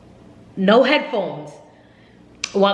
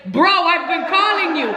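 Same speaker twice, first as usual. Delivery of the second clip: exasperated; 11 semitones higher than usual. Retold or imitated. To the taxi driver who never showed up, exasperated. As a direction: let him know he left you stranded.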